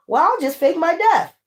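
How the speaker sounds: a hint of a cocky schemer; mocking villain voice. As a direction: toss it off like a smug crook.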